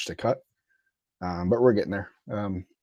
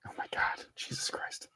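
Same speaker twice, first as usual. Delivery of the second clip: whispered; 11 dB quieter than usual. Real, not acted. Exasperated aside.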